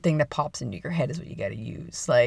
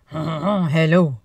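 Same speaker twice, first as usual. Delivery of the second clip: mock-French flirt, like a cartoon; typical French accent. Greeting someone beautiful, in a hammed-up French accent. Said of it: "That's your typical French accent".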